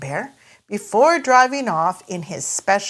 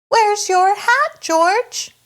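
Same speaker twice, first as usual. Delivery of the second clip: higher, slower motherly voice; Mummy Pig; 13 semitones higher, 4 dB louder, slower than usual. Acted. Mummy Pig asks George where his hat is, higher motherly voice. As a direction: ask it as a gently worried mum. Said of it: "asks Mummy Pig".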